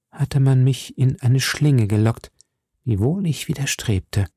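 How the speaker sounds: plain reading voice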